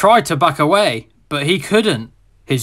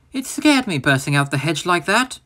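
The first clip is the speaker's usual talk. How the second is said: sad; 4 dB quieter than usual. Acted. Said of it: "Percy told Thomas sadly".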